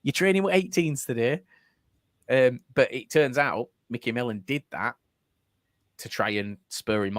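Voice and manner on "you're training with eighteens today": higher breezy manager voice; cheerfully breaking bad news; still happy. Manager breezily telling player he's out, still happy. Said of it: "he was still so happy".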